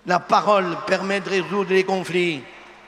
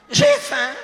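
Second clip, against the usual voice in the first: squeaky high punchline; 6 dB louder than usual. Shown as a demonstration. Priest delivers the joke's punchline about a hungry husband in a high comic squeal. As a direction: jump way up and louder on J'ai faim. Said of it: "ça veut dire quoi?".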